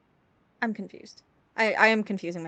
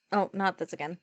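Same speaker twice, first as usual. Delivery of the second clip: normal talking voice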